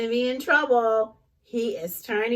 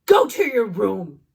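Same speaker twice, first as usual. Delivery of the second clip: louder firm order; stern mom; 3 semitones lower, 7 dB louder than usual. Acted. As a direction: send him off. Mom punishing in story.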